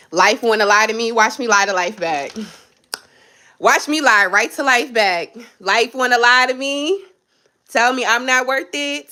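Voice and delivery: loud, high defiant vow; defiant declaration. Real, not acted. Fed up with life, a loud defiant vow. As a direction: punch it out like a battle cry. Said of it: "I'm tired of this".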